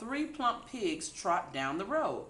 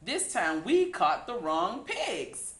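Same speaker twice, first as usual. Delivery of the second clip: laughing; 2 semitones higher, 6 dB louder, slower than usual. A narrator's reading. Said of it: "hooted Sam".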